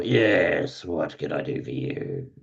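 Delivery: lightly voiced surly servant. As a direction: answer the door with mild surliness.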